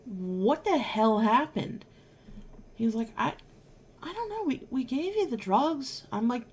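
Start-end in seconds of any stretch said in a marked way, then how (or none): 0.0-1.8 slow, tearful, glaring demand. Retold or imitated. Crying patient glaring at surgeon, slow tearful demand. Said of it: "through my ugly crying". 3.2-6.1 sheepish surgeon. Retold or imitated. Surgeon dodging the crying patient, high and sheepish.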